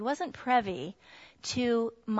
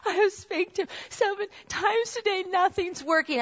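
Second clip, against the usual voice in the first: much higher, strained tearful voice; exhausted mother sobbing to her husband, higher and strained; retold sobbing; 9 semitones higher, 4 dB louder than usual. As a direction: relive the despair, near tears. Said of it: "I was sobbing".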